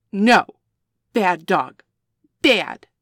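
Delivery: loud sharp reprimand; loud angry dog scolding; stern scolding. Acted.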